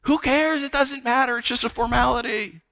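high-pitched, brushing it off; dismissive compromise. Acted. Those facing a high cost excusing bowing down, high and dismissive.

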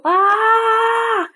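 high, mock-frantic call for help; playful hollering. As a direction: call out across the church in fun. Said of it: "I go around the church hollering".

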